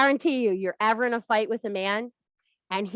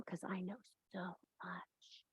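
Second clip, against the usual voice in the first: seductive whisper; 3 semitones lower, 23 dB quieter than usual. Acted. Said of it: "she goes".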